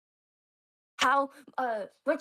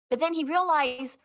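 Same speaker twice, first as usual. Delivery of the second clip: normal talking voice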